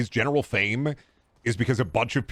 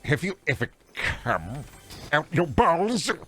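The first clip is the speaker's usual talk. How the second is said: Tim Curry impression; 4 semitones higher, much slower than usual. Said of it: "yeah, Tim Curry".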